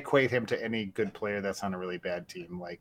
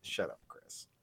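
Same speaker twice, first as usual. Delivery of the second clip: whispered exasperated aside; muttered shut up; 16 dB quieter, slower than usual. Retold or imitated. Fan fed up with the commentator, whispered. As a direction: hiss it under your breath at the TV. Annoyed aside at imitated analyst.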